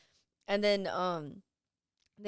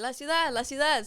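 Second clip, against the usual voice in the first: higher, louder relayed alert; imitated warning call; 6 semitones higher, 5 dB louder than usual. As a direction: relay the alert to the next vendor. Next vendors relaying the warning.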